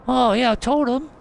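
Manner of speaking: high, loud boasting admirer; eager fan character. Acted. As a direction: answer proudly in a high put-on voice. Same fan figure answers.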